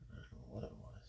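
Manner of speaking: hushed, shaken whisper. Real, not acted. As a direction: breathe it out under your breath. Shaken after animal attack.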